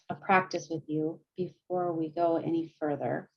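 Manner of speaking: ordinary talk, nothing marked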